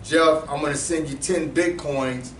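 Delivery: ordinary talk, nothing marked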